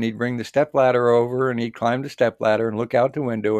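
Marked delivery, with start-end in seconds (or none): none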